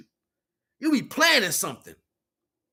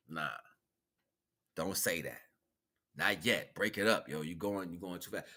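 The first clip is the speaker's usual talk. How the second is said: much lower and softer, murmured caution; low inner voice; 12 semitones lower, 9 dB quieter than usual. Retold or imitated. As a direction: hold yourself back in a low murmur. Inner voice holding him back.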